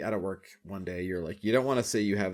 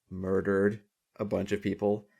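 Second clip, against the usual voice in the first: ordinary talk, nothing marked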